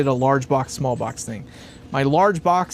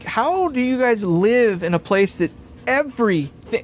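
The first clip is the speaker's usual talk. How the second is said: ordinary talk, nothing marked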